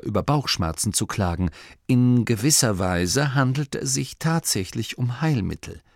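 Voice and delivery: usual speaking voice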